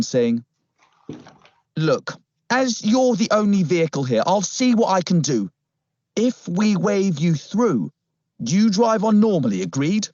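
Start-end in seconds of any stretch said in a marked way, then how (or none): 1.8-10.1 higher, firm officer character; put-on policeman voice. Policeman offers to wave the car through, put-on officer voice. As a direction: lay down terms as the officer, pitched up.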